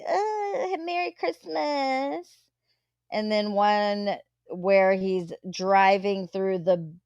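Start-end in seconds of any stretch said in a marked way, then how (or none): 0.1-2.3 mock sappy Ken. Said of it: "he's like".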